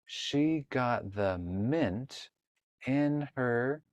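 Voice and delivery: very slow, word by word; slow reading aloud. Shown as a demonstration. Teacher modeling slow reading of a page for a child. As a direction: read it like a beginner, sounding each word. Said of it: "So let's read this page".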